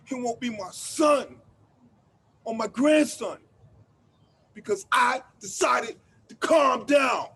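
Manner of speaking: loud, hard and accusing; furious. Acted. To the man telling him to calm down, furious shout.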